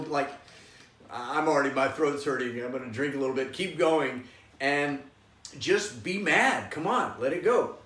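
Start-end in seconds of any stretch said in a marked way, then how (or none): none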